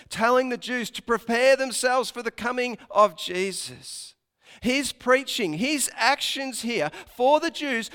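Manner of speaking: usual speaking voice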